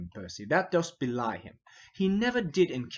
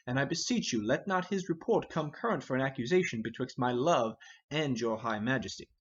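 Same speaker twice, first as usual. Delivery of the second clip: usual speaking voice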